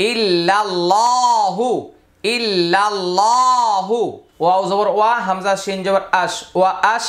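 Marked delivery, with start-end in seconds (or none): 0.1-1.7 ইল্লাল্লাহু recited slowly with a rising melodic end; slow recitation. Shown as a demonstration. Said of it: "হা পেশ হু". 2.4-4.0 ইল্লাল্লাহু chanted slowly in a recitation voice. Shown as a demonstration. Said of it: "হা পেশ হু".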